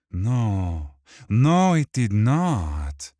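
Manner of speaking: slow, drawn-out mock agreement; villain voice. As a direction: savor each word menacingly.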